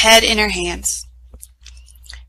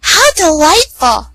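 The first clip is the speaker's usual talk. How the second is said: high, slow, affected delight; a haughty stepsister savoring the news; 8 semitones higher, 6 dB louder than usual. A narrator's reading. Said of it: "the stepsisters said".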